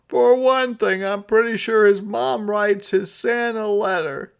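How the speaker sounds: high, loud put-on Santa voice. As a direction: play him sulky and fed up.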